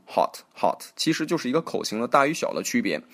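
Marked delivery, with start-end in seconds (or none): 0.1-0.8 British "hot" with a small rounded o; British accent. Shown as a demonstration. Teacher shows how the British read it, British accent. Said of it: "英国人也就读".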